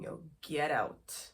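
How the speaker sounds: ordinary delivery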